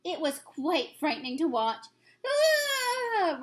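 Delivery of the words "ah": high anguished roaring cry; nightmare-stricken giant crying out; giant's roar. Acted. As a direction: bellow out in agony. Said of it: "roared the flesh lump eater".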